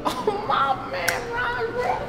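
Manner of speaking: high, slow, gushing greeting; excited greeting. Acted. Rapper greeting his friend Ron, high excited gushing. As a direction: gush over your friend.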